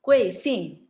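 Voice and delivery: "Kuing Sing" punched, each syllable dropping fast; angry falling fourth tone. Shown as a demonstration. Teacher shows gentle pupil how to be angry, "Kuing Sing" falling. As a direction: say "Kuing Sing" crossly with two steep falls. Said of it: "nggak tahu dia cara marah".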